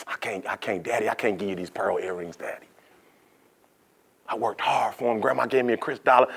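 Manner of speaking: put-on very soft, low pleading child; hushed, halting apology, pitched low; reluctant sad refusal. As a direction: quietly beg Daddy to understand. Girl sadly refuses her daddy.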